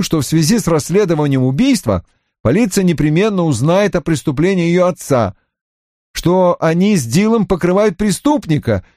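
high, eager-to-justify report; offended young subordinate reporting. Acted. Voices Johnny continuing his report. (высоким голосом обиженного подчинённого)

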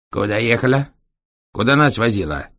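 put-on strict questions in a Chinese accent; stern Chinese accent. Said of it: "строго спросил Сяо Линь с китайским акцентом". (строго, с китайским акцентом)

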